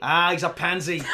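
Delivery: put-on Irish rock star; mock-Irish scoffing; Irish accent. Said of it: "do this Irish accent".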